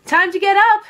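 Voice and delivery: high, loud and rousing; eager wake-up call. A narrator's reading. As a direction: rouse a sleepy little sister. Girl waking her sleepy sister.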